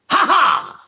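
loud, high, sly cackle; sly villain laugh. Shown as a demonstration. [name] shows how Tybalt should cry out, loud and sly. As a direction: burst in with a scheming laugh. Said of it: "ruft laut und arglistig aus".

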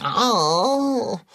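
drawn-out wail of toothache; pained moan. Acted. Cat with toothache complains to mechanic, moaning. Cat in pain after clove remedy.

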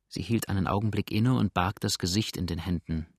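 ordinary delivery

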